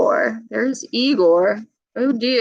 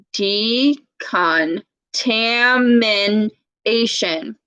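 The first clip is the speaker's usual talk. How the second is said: decontamination drawn out very slowly, syllable by syllable; slowed word; much slower than usual. Shown as a demonstration. Teacher showing kids a long word, said very slowly. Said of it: "look at this word again".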